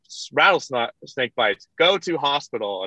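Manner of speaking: dry, slow recitation of a manual; mock-solemn slow reading; slow deadpan reading. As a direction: read it out slowly, word by word. Friend reading first aid notes.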